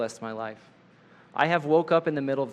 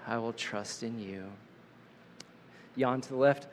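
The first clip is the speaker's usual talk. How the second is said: guided breathing; 2 semitones lower, 6 dB quieter than usual. Acted. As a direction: guide the room gently through each breath. Leads a guided breathing exercise.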